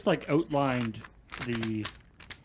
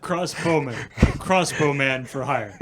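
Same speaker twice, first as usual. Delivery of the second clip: grand announcer voice; 8 dB louder than usual. Pretend. Hamming up game unit.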